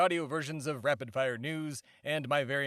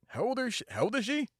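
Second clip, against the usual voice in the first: high, startled double-take; surprised; 9 semitones higher, faster than usual. Acted. Host asking the swearing listener's age, high startled disbelief. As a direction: jump up in pitch in disbelief.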